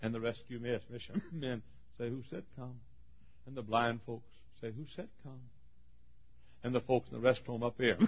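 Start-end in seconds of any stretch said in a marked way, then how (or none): 2.1-2.8 very soft imagined question; hushed question. Acted. Voices the congregation's question. 4.7-5.4 very soft imagined question; hushed question. Acted. Voices the congregation's question.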